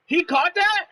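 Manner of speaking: high, loud cry of disbelief; shocked shout. Real, not acted. Reacting to a catch in the game, loud shocked shout. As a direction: yell it in shock at the screen.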